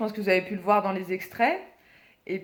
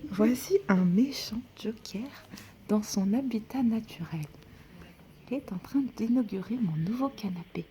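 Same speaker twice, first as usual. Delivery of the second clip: whispered wildlife narrator voice; hushed nature documentary; 4 dB quieter than usual. Pretend. Sleeping friend filmed like wildlife.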